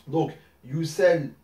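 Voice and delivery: yusal drawn out slowly; slow demo. Shown as a demonstration. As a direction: stretch yusal for students to follow. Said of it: "une autre écriture aussi possible".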